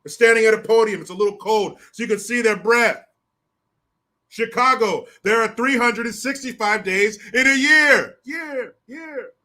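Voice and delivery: loud, high podium announcement; mock official speech. Chicago officials at a podium making a loud pompous announcement. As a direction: proclaim it like a city official.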